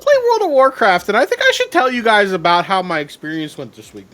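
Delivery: high, loud goofy coach voice; loud cartoon coach; Coach Steve voice. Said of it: "Why do you sound like the coach from Big Mouth?".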